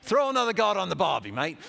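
loud, high, exaggerated Australian accent; quick, hammed-up Aussie accent. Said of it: "it's supposed to be an Australian accent".

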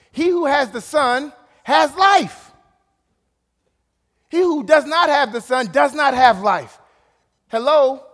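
loud, high, slow proclamation; preaching shout. Acted. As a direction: thunder the verse to the crowd. Preaching climax.

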